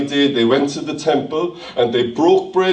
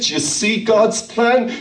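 usual speaking voice

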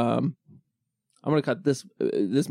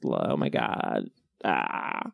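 put-on slow, low, creaky Valley Girl; Valley Girl accent; 4 dB quieter, much slower than usual. Demonstrating a West Coast accent, overdone drawling Valley Girl. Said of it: "Valley Girl? Has that become an accent?".

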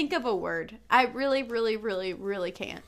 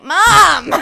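exasperated; 7 semitones higher, 13 dB louder than usual. Retold or imitated. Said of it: "And I'm like".

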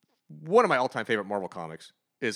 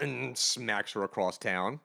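normal talking voice

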